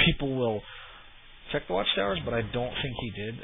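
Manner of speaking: ordinary delivery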